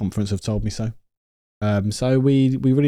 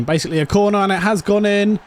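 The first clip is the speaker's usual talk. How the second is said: excited goal call; 9 semitones higher, 4 dB louder, faster than usual. Real, not acted. Goal goes in.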